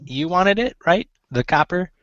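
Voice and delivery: high-pitched gnome woman. Acted. Gnome seamstress asking about the copper spool, high gnome voice. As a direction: ask it up high as a little gnome. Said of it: "let me get my gnome voice on".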